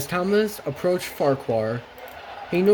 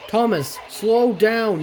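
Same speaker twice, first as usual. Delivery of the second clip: raised, higher-pitched driver's call; yelled warning; 7 semitones higher, slower than usual. A narrator's reading. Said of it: "yelled his driver".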